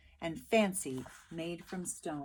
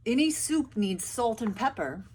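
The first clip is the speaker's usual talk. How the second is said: louder, hearty soldier voice; soldiers' voice; 4 semitones higher, 8 dB louder than usual. A narrator's reading. Soldiers tempting the villagers, hearty and loud. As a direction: boom it out like a soldier. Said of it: "said the soldiers".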